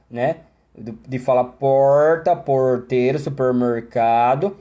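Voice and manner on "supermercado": ordinary talk, nothing marked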